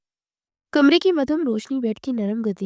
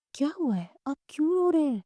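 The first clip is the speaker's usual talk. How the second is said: higher, worried questioning; mildly alarmed; 5 semitones higher, 4 dB quieter than usual. A narrator's reading. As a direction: ask with a touch of panic, pitch lifted. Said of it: "وہ گھبرا گئی".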